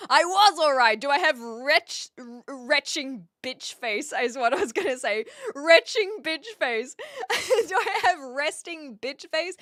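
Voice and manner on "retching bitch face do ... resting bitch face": laughing at a slip. Real, not acted.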